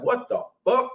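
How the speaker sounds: high, stunned what-the; shocked disbelief. Retold or imitated. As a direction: squeak out baffled disbelief. Shocked by attractive girl's interest.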